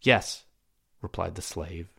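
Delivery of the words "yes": louder, fearless yes; defiant one-word reply; intrepid. Acted. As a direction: answer boldly, without flinching. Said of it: "in an intrepid tone".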